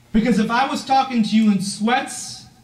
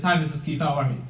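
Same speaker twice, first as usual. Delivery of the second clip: lower, softer, steady and sure; confident greeting; 6 semitones lower, 5 dB quieter, faster than usual. Retold or imitated. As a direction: greet her calmly, eye to eye. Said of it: "Every time I met her after".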